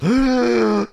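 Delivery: high, sharp mock gasp of a crowd; crowd gasp imitated. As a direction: imitate the crowd gasping in shock. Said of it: "The crowd goes".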